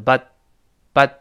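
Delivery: "but" short, final t unreleased; quick "but" with no t burst; fast reading. Shown as a demonstration. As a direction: say "but" quickly, stopping the t on the tongue. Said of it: "读快就是".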